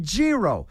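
"gyro" said as JEE-roh; insisted pronunciation. Shown as a demonstration. Host insists on his own version of the word, pronounced JEE-roh. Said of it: "It's pronounced gyro".

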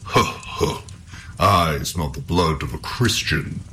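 slow storybook troll with a puffed ho-ho. A narrator's reading. Troll squeezes through the door smelling Halvor, menacing. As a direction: sniff out prey as a lumbering troll. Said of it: "he cried".

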